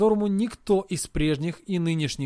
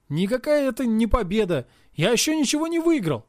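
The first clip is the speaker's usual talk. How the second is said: high, insistent protest; 6 semitones higher than usual. Acted. Hero insisting to his friend it is no win. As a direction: push back hard.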